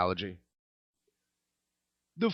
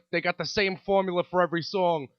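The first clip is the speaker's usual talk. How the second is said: ordinary delivery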